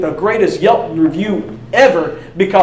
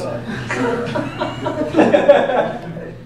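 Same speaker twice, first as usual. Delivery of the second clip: laughing; 6 semitones higher than usual. Real, not acted. Laughs at own joke.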